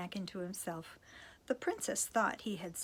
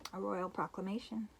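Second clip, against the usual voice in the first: plain reading voice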